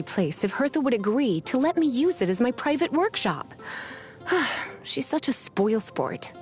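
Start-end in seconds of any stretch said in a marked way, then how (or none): 4.2-4.8 short, falling sigh; disappointed sigh. Acted. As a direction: sigh at a spoilsport. Disappointed at being refused the room.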